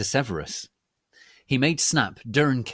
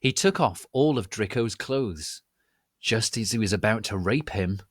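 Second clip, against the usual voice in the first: plain reading voice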